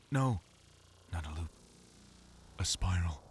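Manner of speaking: a lightly brooding narrator, long pauses, the last words whispered; mock noir monologue, spaced out line by line; Alan Wake narration. Voice actor imitates a brooding game narrator for his friend. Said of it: "I like doing an impression of Alan Wake".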